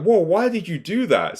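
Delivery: lightly acted shocked dad; alarmed dad. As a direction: play the father startled and demanding. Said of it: "The dad's like".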